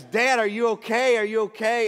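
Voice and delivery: put-on high, loud alarmed kid voice; worried daughter. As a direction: cry out like a worried little girl. Daughter worried after fall.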